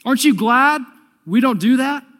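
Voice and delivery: high, loud startled outburst; shocked whoa. Acted. Religious leaders shocked Jesus reads their thoughts, high startled yelp. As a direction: yelp in alarm, voice jumping up. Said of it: "and they're going".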